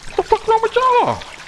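high, outraged yell at the players. Real, not acted. Gamer yelling at teammates who messed up, outraged. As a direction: shriek it in disbelief.

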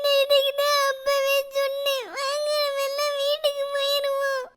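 high, told-you-so little voice; whiny kid. Acted. Child tells friends she warned them, high whiny voice. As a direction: whine it like a scared child.

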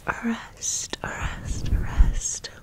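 usual speaking voice

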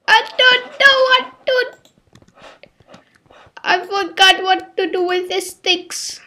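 high, loud helpless wail; high-pitched panic. Real, not acted. Player forgot what to do with the sticks, high-pitched panic. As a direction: squeal in helpless confusion.

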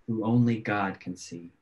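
normal talking voice

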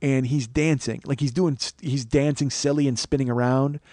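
ordinary talk, nothing marked

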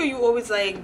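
ordinary talk, nothing marked

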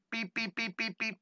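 high, soft beep beep; mock bus beeps. Teacher playing a bus horn for the kids, high and soft. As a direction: tootle like a toy bus horn.

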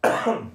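coughing fit after a drink; choking cough. Real, not acted. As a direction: cough to clear the throat, choking. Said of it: "je m'étouffe".

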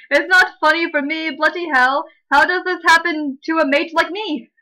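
hammed-up Brit in trouble; mock British dismay. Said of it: "How's that British?".

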